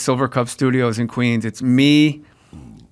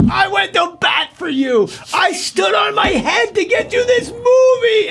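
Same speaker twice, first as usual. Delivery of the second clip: very high, strained shouting, slowing at the end; retold furious yelling. Director berating the actor he fought for, furious yell. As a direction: scream it as an aggrieved director, acted out in full.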